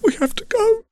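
frantic prince begging; desperate young prince. Acted. Prince voiced, high and desperate.

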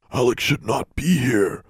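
ordinary delivery, nothing marked

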